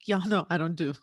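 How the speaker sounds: usual speaking voice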